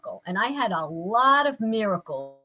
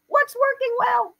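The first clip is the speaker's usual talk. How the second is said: high sing-song; 14 semitones higher than usual. Shown as a demonstration. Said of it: "there's a thing called W W W".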